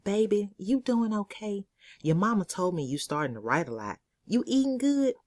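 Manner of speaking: a light touch of a doting church sister; church lady. Acted. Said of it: "she came up to me after church and said".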